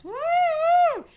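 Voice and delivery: high, loud imitated police siren; siren whoop. Police cars racing past the family, loud siren whoop.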